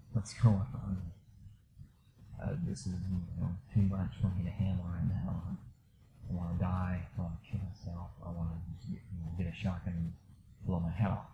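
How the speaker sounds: soft, partly whispered despairing thoughts; hushed dark inner voice. Retold or imitated. Retelling his suicidal thoughts alone in bed, hushed. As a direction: let the words sink to a near whisper.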